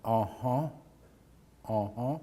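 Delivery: quiet rising uh-huh, nodding along; soft agreeing uh-huh. Retold or imitated. Wife agreeing behind remodeler, soft rising uh-huh. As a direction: murmur agreement behind him. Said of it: "His wife was standing behind him going".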